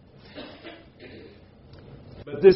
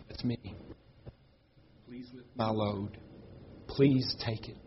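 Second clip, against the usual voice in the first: soft, much quieter pleading prayer; quiet plea; 15 dB quieter than usual. Real, not acted. Grieving preacher quietly praying God to lift his load. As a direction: pray it softly, almost to yourself.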